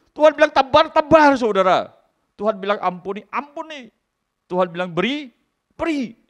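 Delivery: high, loud echoed commands; preaching at full force. Acted. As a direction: call each word back to the crowd. Preacher echoing commands loudly. (khotbah lantang, kata diulang)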